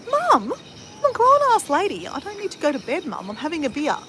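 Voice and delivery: annoyed daughter telling mum she's a grown-ass lady; pissed-off retort. Retold or imitated. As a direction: whine it at mum like a fed-up grown woman. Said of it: "I was really pissed off".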